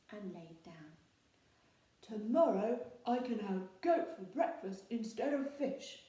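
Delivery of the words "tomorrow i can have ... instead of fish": loud, dreamy troll voice; a troll dreaming of breakfast; sleepy troll musing. A narrator's reading. Troll drifting off to sleep, musing on goat. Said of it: "he thought".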